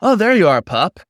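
bright high call of welcome; Dan, cheerful greeting. Acted. Dan greets Paul back at the campfire, high and glad.

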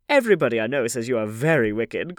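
emphatic society-hostess exclamation; crying out. A narrator's reading. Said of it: "cried the old lady".

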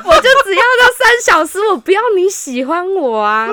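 high, laughing protest; laughing while talking. Real, not acted. Telling friends, laughing high protest. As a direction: push the words through laughter.

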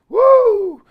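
high, loud woo; excited whoop. Real, not acted. Player bursting to pee in a game, whooping high and loud. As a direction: let out a whoop.

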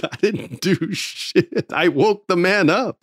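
high, slow, disbelieving protest; incredulous disclaimer. Retold or imitated. Writer sitting by his boss as he gets praised, incredulous and high. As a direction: stretch it out high, baffled by the credit. Amazed at getting undeserved credit.

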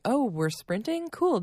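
fake-casual delight at sprinting; surprised and game, rising high on the last word; mock surprise. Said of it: "and I was like".

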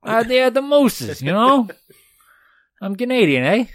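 hammed-up Brooklyn-Canadian guy; Brooklyn-ish Canadian. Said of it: "he sounds like he's from Brooklyn".

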